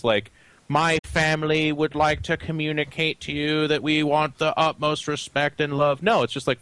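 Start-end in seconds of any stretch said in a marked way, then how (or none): 0.7-6.0 mock-formal sincerity. Parodying what gamers supposedly mean by GG, mock-formal. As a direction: deliver it like a solemn official message. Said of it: "what they mean is".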